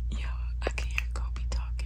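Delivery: hushed whisper from the closet; whispering while hiding. Real, not acted. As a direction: whisper so nobody finds you. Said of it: "I'm hiding in the closet".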